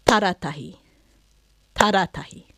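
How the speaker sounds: Taratahi stressed on its opening Ta, then dropping; stress on first syllable. Shown as a demonstration. Teacher saying place names aloud, first-syllable stress on Taratahi. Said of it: "so you can hear with a clap where that".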